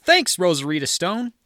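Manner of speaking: high, loud announcer call rising at the end; hyped announcer. Pretend. Comic calls out the show name, hyped announcer. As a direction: shout the name like a ring announcer.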